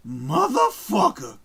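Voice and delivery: slow, drawn-out movie-gangster curse, lightly voiced; Ving Rhames' line. Streamer imitating the Pulp Fiction actor, a hint of a slow gangster curse. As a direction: drag the curse out like a tough guy in a film. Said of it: "That's how he says it".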